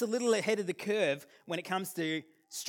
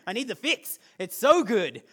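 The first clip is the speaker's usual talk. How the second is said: normal talking voice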